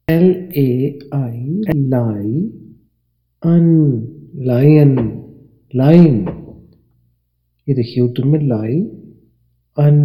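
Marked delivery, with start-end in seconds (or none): 0.1-3.7 slow spelling. Shown as a demonstration. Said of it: "अब इसकी आवाज़ देखिए". 4.4-6.2 lion said as lay-an, the second louder; model pronunciation of lion. Shown as a demonstration. Teacher modelling lion for pupils. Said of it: "अब इसकी आवाज़ देखिए".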